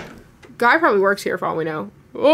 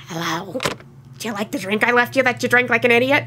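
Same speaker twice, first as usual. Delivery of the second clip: creepy man; 4 dB quieter than usual. Acted. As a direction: voice the creepy stranger, quiet and menacing. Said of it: "It's gonna be that creepy man".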